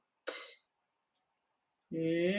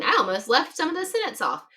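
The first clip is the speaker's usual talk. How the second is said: ordinary talk, nothing marked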